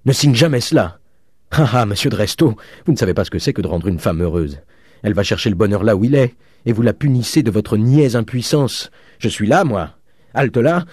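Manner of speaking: loud, high-pitched indignant tirade; crying out. A narrator's reading. Father defends daughter against her husband, crying out indignantly. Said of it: "cria le père Goriot".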